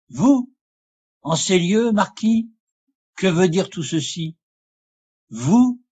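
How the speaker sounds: high, sharply rising questions; incredulous surprise. A narrator's reading. Lorenzo finds the marquis in this place, incredulous surprise. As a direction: question it in disbelief, pitch leaping up.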